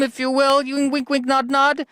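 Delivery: high, teasing wink-wink voice; high mock-coy innuendo; sly innuendo. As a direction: nudge the listener, high and sly. Bawdy innuendo aside.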